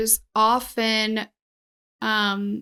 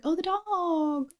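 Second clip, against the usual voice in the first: dawning realization; 6 semitones higher, 6 dB quieter, slower than usual. Retold or imitated. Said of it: "I was like".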